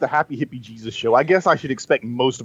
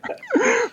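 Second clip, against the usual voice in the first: laugh. Real, not acted. Laughs at the podcast slam.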